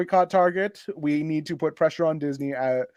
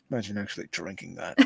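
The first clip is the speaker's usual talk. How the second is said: snooty guy voice; 9 semitones lower, 14 dB quieter, faster than usual. Acted. Voices rude guy in post.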